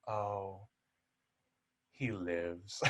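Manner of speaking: low, hushed mock lament; sorrowful Morticia. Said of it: "the sorrow in her face".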